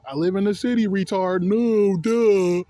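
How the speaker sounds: loud, exasperated comeback, ending on a big drawn-out duh; mocking no-duh. Job applicant mocking silly assumptions, loud sarcastic no-duh.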